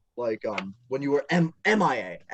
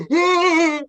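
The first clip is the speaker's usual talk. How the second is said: sudden high startled shout; startled yelp; 11 semitones higher, 8 dB louder than usual. Retold or imitated. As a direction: yelp it out in fright. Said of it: "I heard that and I went".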